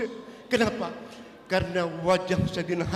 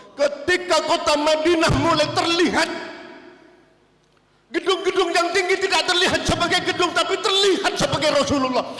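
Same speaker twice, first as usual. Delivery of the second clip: rising, louder sermon climax; impassioned preaching; 6 semitones higher, 4 dB louder than usual. Acted. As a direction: preach it with mounting fervour. Preacher building to emotional peak. (khotbah yang makin berapi-api dan lantang)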